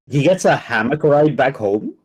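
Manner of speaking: ordinary delivery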